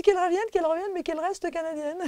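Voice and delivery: put-on high, fluting voice of an elderly singing teacher. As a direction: play the grand old teacher, insisting. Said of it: "Elle dit".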